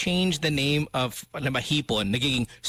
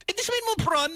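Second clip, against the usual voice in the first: ordinary talk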